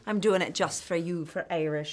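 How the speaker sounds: bad Irish accent put on for fun; lilting mock Irish accent. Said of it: "This is terrible Irish accent".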